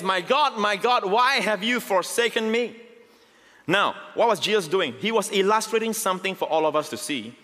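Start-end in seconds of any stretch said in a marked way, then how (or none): 0.0-2.8 higher, slow pleading cry; mild anguished cry. Acted. Jesus forsaken on the cross, slow pleading cry. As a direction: stretch it out like a dying plea. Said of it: "cried out with a loud voice".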